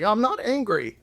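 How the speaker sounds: mock-angry denial, first words high; a touch of mock irritation; insisting not angry. Husband acting out an irritated denial, mock angry.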